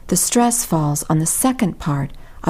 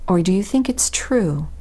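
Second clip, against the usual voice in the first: ordinary talk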